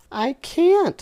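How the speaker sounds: high, loud mock-haughty falsetto; condescending voice. Dipsy refusing his friend, condescending falsetto. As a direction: talk down to him in falsetto. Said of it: "said Dipsy with a condescending voice".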